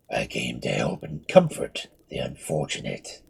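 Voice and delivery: low, hollow, calm mummy; mummy's voice. Acted. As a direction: speak as an ancient undead comforter. Mummy speaks.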